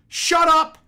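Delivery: loud, high shout at the screen; yelling. Retold or imitated. Viewer yelling at a terrible movie on TV, furious reenacted shout. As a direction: bellow it out in exasperation. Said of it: "I literally yelled at the T V".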